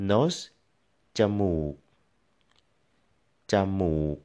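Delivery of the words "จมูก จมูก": จมูก with จะ flat, then มูก low; level start, low finish on จมูก. Shown as a demonstration. Teacher modelling จมูก for learners, flat then low tone. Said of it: "flat and then low tone".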